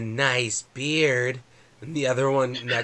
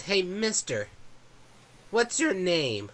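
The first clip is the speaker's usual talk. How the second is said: put-on high child voice, slowing at the end; curious little boy; 7 semitones higher, slower than usual. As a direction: ask like a shy kid. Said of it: "Mikey looks up at you and he goes".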